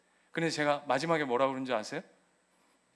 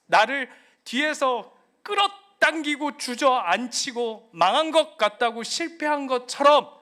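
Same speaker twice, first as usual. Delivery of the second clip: loud, raised pulpit voice; forceful preaching; 8 semitones higher, 9 dB louder than usual. Acted. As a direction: drive it home to the congregation.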